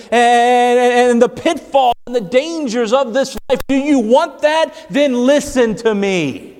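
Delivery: high, driving preacher's voice, pressing each phrase home; full-force preaching. Acted. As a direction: preach it hard to the congregation.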